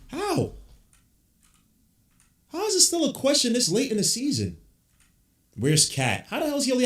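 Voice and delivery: can't believe it's still a question; exasperated disbelief. Real, not acted. Ranting at his team's play.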